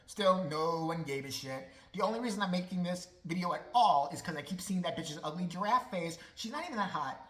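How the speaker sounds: ordinary delivery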